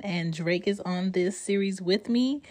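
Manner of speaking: ordinary talk, nothing marked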